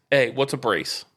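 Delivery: ordinary delivery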